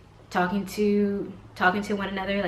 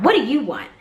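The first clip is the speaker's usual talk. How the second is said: loud, raised mock snap; irate retort; 6 semitones higher, 6 dB louder than usual. Irate mom turns around to the interrupter, snapping. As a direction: imitate an irritated woman turning on someone. Retold irate woman snaps back.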